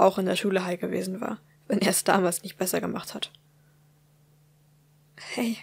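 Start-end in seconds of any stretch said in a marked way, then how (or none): 5.2-5.6 soft, hesitant hey; quiet hey. Acted. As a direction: barely greet him. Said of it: "fing sie schließlich leise das Gespräch an".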